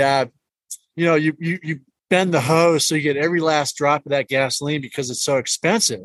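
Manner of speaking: normal talking voice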